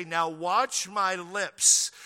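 slow, over-articulated lip-reading phrase; slow demonstration. Retold or imitated. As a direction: mouth each word slowly for kids. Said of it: "she would say".

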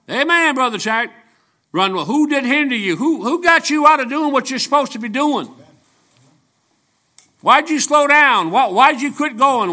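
high, loud, pleading preacher's cry; full-force preaching. Acted. Preacher calling out the congregation, loud, high and pleading. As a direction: drive each question home from the pulpit.

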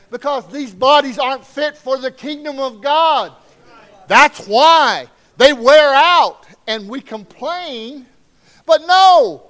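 high, loud climbing preacher's cry, slowing on the peak; preaching at full force. Acted. Pastor pressing home the point to his church, full-voiced cry. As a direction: build it up and cry it out to the rafters.

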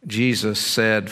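normal talking voice

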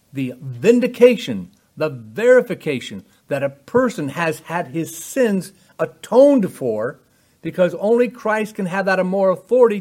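full-force preaching. Acted. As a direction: preach it hard to the congregation.

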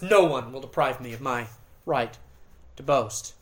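low, soft "No one will deprive me of my right to boast" under the breath; quietly tossed off after a pause; mumbled aside. Shown as a demonstration. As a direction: mutter it as a throwaway aside. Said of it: "As if he's kind of mumbling it under his breath".

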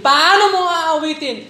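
pressing the Paano mo aawitin again; emphatic repeat. Real, not acted. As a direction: push the question harder. Repeated rhetorical question.

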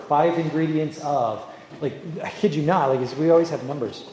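usual speaking voice

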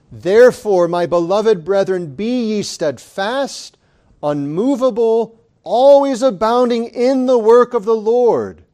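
loud, high fervent preaching; preaching scripture. Acted. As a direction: proclaim the verse with full force. Reading scripture at full force.

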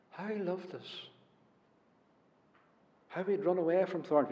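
ordinary delivery

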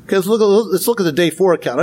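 usual speaking voice

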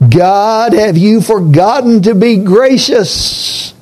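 high, slow plea to God; pleading lament. Acted. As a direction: cry out the question to God slowly. Psalmist's lament quoted aloud.

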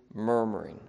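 low, soft murmur of one word. Shown as a demonstration. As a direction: drop the pitch and murmur it softly. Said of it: "say it in a low tone".

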